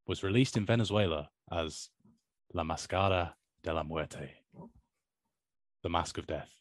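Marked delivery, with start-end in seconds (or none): 2.5-4.3 "La Máscara de la Muerte" in Spanish, "Máscara" as MAS-ka-ra, "Muerte" as MWER-te; Spanish pronunciation. Shown as a demonstration. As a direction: read "La Máscara de la Muerte" with Spanish vowels, "de la" soft and quick. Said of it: "pardon my, uh, Spanish, Spanish pronunciation".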